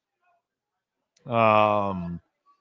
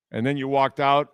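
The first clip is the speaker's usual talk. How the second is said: usual speaking voice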